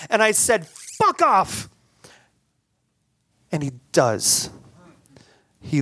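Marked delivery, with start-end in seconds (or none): 1.0-1.7 defiant. Retold or imitated. Defying the mugger.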